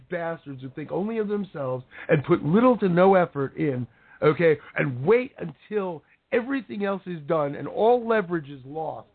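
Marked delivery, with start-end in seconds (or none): none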